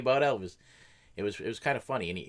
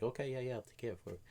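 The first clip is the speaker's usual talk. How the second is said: softer, fading dismissive reply; offhand brush-off; 3 semitones higher, 7 dB quieter than usual. Retold or imitated. Forklift driver brushes off a coworker, fading softly. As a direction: mumble agreement while not really listening. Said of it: "and I'd be like".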